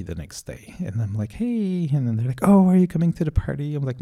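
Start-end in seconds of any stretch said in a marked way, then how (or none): 1.3-1.9 bright high hey; cheery greeting. Retold or imitated. Greeting the party crew in town, bright and high. 2.4-3.7 fast, loud and high; excited question. Retold or imitated. Party people eagerly asking him along, fast and high.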